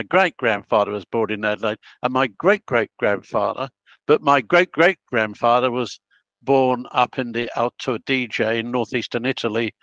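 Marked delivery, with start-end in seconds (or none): none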